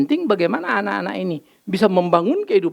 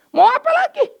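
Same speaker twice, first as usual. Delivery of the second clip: high, louder exasperated shrug; resigned question; 5 dB louder than usual. Real, not acted. Preacher to congregation about God counting sins, exasperated. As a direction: throw up your hands, much higher.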